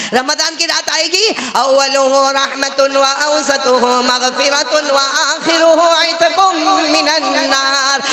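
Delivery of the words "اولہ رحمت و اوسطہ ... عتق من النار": very slow, high chanted Arabic; Arabic recitation. Acted. Preacher chants the hadith in Arabic, very slow and high. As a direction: draw out each word melodiously.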